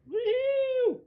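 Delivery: loud, high-pitched drawn-out woohoo; falling whoop. Pretend. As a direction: whoop loudly as if dropping down a chute. Whooping during a drop in game.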